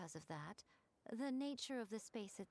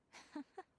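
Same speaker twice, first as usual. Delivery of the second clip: light laugh; 8 semitones higher, 3 dB quieter than usual. Acted. Amused at a rash choice.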